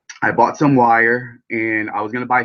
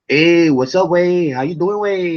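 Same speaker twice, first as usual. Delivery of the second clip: a lightly put-on Mexican accent, higher and louder, stretching "way"; mock Mexican accent; 8 semitones higher, 3 dB louder than usual. Streamer imitating her Mexican friends' slang, mock Mexican accent. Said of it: "a lot of Mexicans say "way".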